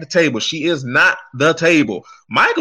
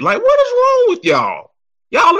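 exasperated outburst; 3 dB louder than usual. Real, not acted. Exasperated rant at fans.

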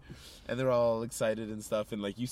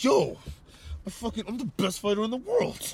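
higher, louder angry bragging; mock furious boast; 8 semitones higher, 4 dB louder than usual. Fighter yelling at the girl, mock furious. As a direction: puff up and rage at a child. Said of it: "He is mad at this little girl".

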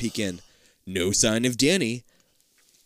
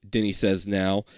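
usual speaking voice

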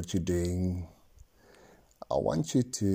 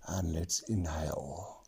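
whispered breathing cue; whispering; 6 dB quieter than usual. Acted. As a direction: breathe the words out.

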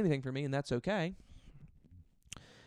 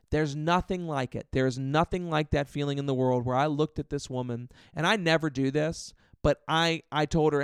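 normal talking voice

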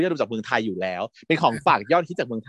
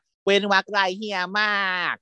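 higher, slow sassy line; mock sassy quote; 7 semitones higher, slower than usual. Host quoting the sassy auntie's classic line, slowed and higher. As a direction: deliver it like a camp auntie. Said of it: "คุณเจ๊แต๋วก็บอกว่า".

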